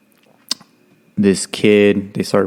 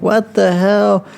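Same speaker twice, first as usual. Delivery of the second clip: high, slow, drawn-out bewilderment; imitated startled recruit; confused surprise; 10 semitones higher, much slower than usual. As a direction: sound jolted awake and lost.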